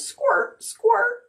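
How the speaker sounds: very high squeaky toy voice; tiny high rubber-duck squeak. Acted. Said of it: "all he could say was".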